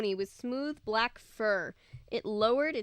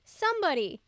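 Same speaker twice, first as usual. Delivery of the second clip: higher call for help; 7 semitones higher, 3 dB louder than usual. A narrator's reading. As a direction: shout for anyone nearby. Calling for help.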